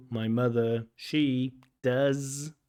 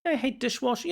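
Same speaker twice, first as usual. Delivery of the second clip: high mock repeat of the student; candidate's phrase echoed back in a high voice; 12 semitones higher, faster than usual. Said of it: "Usually we use".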